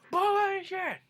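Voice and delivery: high, loud outburst; mock angry retort. Grandpa rejecting the taunt, mock-furious high yell. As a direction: yell it as an imitated furious objection.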